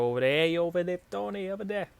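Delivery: rough, high Boston accent impression. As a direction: do a quick Boston accent, pitch climbing up. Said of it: "I don't know if that was a Boston accent or not".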